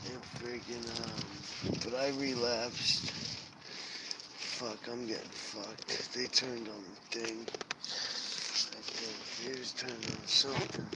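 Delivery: dropping to a hushed murmur. Real, not acted. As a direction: talk low so nobody overhears. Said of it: "I yelled too loud".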